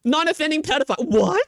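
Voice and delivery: high, loud mocking disbelief; mocking incredulity. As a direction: scoff it out incredulously. Mock outrage at phrase.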